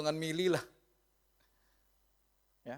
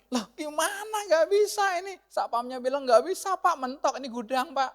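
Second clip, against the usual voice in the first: high, loud protest down the phone; acted frustration, pitched way up; exasperated complaint; 9 semitones higher, 6 dB louder than usual. Lost driver phoning the customer about a dead end, exasperated.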